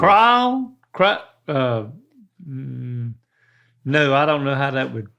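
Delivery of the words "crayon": "Crayon" squeezed toward one syllable, higher, questioning; one-syllable attempt. Shown as a demonstration. Dad guessing at the quiz, "Crayon" squeezed into one syllable. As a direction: try "Crayon" as a single drawn syllable, pitched up. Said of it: "Some people say it as one syllable".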